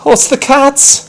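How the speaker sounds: high, loud startled cry; declared. Acted. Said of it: "declared Peter".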